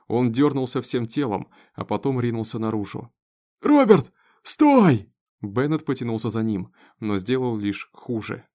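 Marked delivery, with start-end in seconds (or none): 3.6-5.1 loud, high call to stop; desperate shout. A narrator's reading. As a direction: shout after a fleeing son. Shouting after fleeing son. (отчаянный крик вдогонку)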